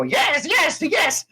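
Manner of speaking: shrill, shouted goblin cheer. Acted. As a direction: squeal yes like excited goblins. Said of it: "the goblins go".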